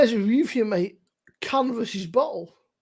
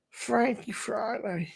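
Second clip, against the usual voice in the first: mock slogan; 5 dB quieter, slower than usual. Echoing the beer slogan again as a mock drawl. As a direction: intone the catchphrase like a lazy ad.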